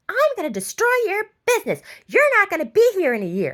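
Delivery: high, loud mock screaming; imitated screaming. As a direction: imitate an upset man yelling threats. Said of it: "started screaming at me".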